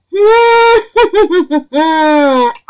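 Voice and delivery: high, loud, giddy laugh; excited laugh. Real, not acted. Thrilled over the reveal.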